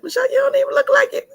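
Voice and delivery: high quick mock audience voice; imitating the audience. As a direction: toss it off like surprised fans. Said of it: "I know y'all said".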